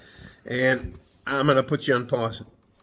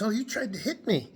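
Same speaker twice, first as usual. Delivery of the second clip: higher, whiny accusation; mock accusing woman; 10 semitones higher than usual. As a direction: imitate her indignant whine. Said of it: "And she goes".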